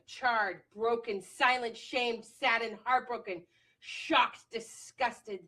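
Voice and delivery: emphatic, spoken-word style recitation; impassioned journal reading. Real, not acted. Woman reading her post-election journal to viewers, impassioned. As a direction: declaim it with punched key words, building in force. Reads own journal entry aloud.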